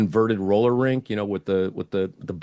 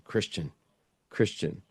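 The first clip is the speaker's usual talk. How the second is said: muttered to himself; 6 dB quieter than usual. Retold or imitated. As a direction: turn the word over quietly in your head. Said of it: "I'm thinking this in my head".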